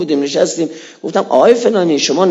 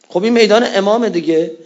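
ordinary delivery

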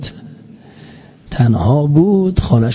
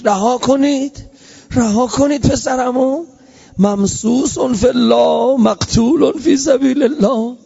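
retold cry; 7 semitones higher than usual. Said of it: "فریاد کشید".

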